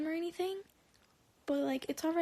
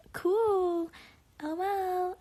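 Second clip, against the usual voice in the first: loud, higher mock-cheerful dismissal; higher, louder sarcastic quip; sarcastic reaction; 5 semitones higher, 5 dB louder, much slower than usual. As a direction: toss it out loud and high, dripping sarcasm. Sarcastic reaction to his reply.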